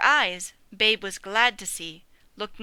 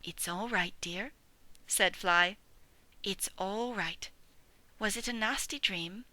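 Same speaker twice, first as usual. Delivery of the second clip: soothing mother; 6 dB quieter than usual. Acted. Mother dog comforting scared piglet, soft soothing voice. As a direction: hush him gently back to calm.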